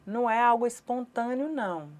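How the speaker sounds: ordinary talk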